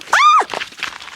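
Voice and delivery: sudden high scream of fright; phobic panic. Real, not acted. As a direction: shriek at a spider. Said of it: "I'm terrified of spiders".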